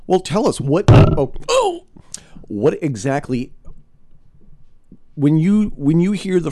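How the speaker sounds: excited. Real, not acted. Host eager for the guest's research.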